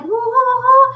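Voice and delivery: put-on high, playful woo woo of a small boy; little boy cooing. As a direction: coo it up high like a smitten five-year-old. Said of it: "and he's like".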